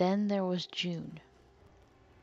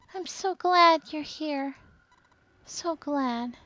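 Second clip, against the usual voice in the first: put-on high, slow, weary girl's voice; weak mumble; 8 semitones higher, slower than usual. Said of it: "She mumbled the same words over and over, weakly".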